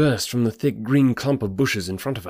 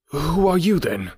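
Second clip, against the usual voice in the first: startled demand; 7 semitones higher than usual. A narrator's reading. Ruffian startled by stranger's authority.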